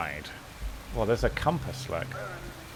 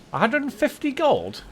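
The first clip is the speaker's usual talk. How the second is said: much higher, outraged question on gold; shocked at the price; 12 semitones higher than usual. Real, not acted. Player balking at the rogue's fee, shocked and high. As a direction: balk at the price in disbelief. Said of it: "That's most of my gold".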